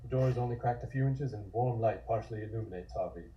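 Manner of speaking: ordinary delivery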